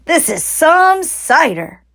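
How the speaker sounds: high, slow, breathless marvel; a cub reeling from the drink; gasping. Acted. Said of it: "gasped the smallest fox".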